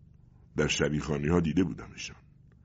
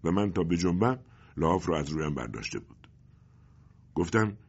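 plain reading voice

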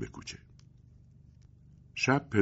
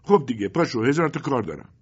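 much higher, louder voice of a scolding mother; nagging mother; 8 semitones higher, 6 dB louder than usual. Acted. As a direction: press him like a fed-up wife. Mother rouses the boy. (مادری غرغرو با صدای بلندتر و زیرتر)

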